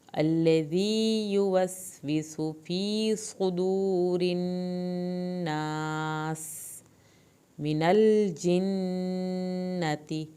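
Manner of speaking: very slow chanted recitation with long held vowels; Quranic recitation. Shown as a demonstration. Teacher recites the verse for her students, slow and chanted. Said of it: "آخر میں سیٹی کی آواز نکالنی ہے".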